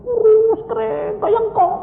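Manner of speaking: high-pitched mocking description; exaggerated squeal. Preacher describes himself looking like a grandpa, high comic squeal.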